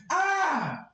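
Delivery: high sung metal wail; metal scream. Retold or imitated. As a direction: belt the [name] ah. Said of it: "[name] by [name]".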